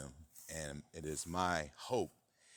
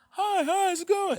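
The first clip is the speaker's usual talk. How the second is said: very high, loud squeaky falsetto greeting; put-on character voice; 10 dB louder than usual. Man attempting to impersonate the entity, high squeaky impression. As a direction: squeal a greeting in a pretend cartoonish voice. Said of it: "pretend to be him".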